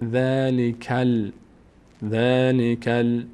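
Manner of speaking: ذَلِکَ ال- slow and level, run into a closing L; joining demo. Shown as a demonstration. Teacher joining kaf to lam, slow joining demo. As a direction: hold the vowels, then land on a still L in ذَلِکَ ال-.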